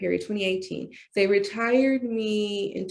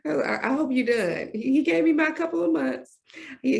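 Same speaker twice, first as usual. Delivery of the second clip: ordinary talk, nothing marked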